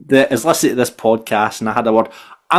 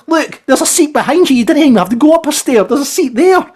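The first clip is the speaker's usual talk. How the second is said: exasperated; 13 semitones higher, 4 dB louder than usual. Retold or imitated. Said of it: "And I said".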